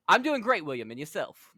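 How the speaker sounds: put-on a fuller British accent reply. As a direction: commit to the English accent this time. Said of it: "You don't have to do the accent".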